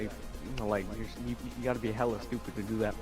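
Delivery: ordinary delivery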